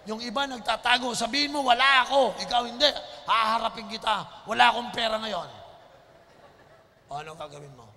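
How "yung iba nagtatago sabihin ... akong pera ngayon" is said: loud, fervent preacher delivery; preacher urging boldness, at full force; full-force preaching. Acted. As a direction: preach it out to the congregation.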